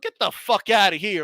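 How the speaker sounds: ordinary delivery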